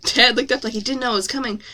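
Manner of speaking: ordinary delivery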